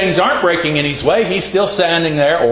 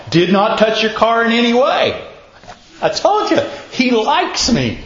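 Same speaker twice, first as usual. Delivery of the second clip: mock-thrilled, pitch climbing much higher on I told you; imitating excited friend; 8 semitones higher than usual. Store clerk Vicky bursting with news about the car, mock excited. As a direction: retell her delight, rising up high.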